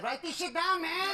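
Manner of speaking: put-on high-pitched Mexican accent; lightly put-on Mexican accent. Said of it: "funny Mexican accent".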